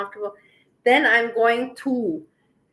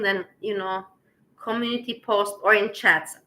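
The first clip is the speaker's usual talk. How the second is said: ordinary delivery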